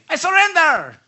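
loud, high mock-French cry; French impression. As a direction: shout it as a comic French accent caricature. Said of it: "That was my French impression".

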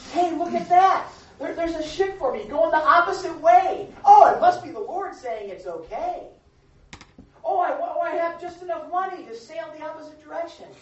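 a hopeful runaway making excuses; imagined Jonah. Retold or imitated. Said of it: "I'm wondering if he went to Joppa and said".

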